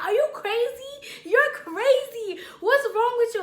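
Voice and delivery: high, loud retold reproach; exasperated scolding. Boyfriend grabbing girlfriend, loud exasperated scolding. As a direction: act out disbelief at her behaviour.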